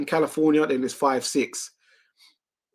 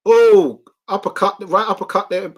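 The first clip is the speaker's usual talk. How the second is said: loud, higher shout at the uppercut; live ringside call of a big uppercut; excited call; 4 semitones higher, 8 dB louder than usual. Real, not acted. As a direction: burst out as it lands.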